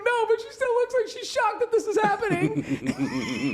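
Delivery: very high, slowed mock wail; hysterical reply. Uncle answering niece outside, mock hysterical.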